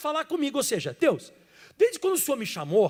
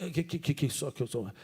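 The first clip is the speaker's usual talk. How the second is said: much lower, softer, stammering on po- and ado-; stuttering Moses; 14 semitones lower, 7 dB quieter than usual. Acted. Pastor plays stuttering Moses before Pharaoh, low and stammering. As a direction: Moses stammers through his plea, low and soft. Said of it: "Eu vou fazer o papel de Moisés".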